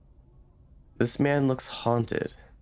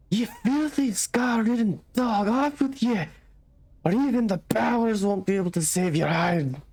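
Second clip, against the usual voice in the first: angry villager; 11 semitones higher than usual. Acted. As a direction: spit the curses at him. Villager curses departing stranger.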